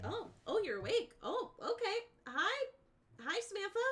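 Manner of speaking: high, sing-song cheerful nurse. Retold or imitated. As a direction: greet a patient brightly.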